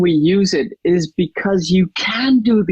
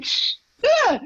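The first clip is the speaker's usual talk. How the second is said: amazed; 9 semitones higher, slower than usual. Retold or imitated. Her own amazed reaction, retold.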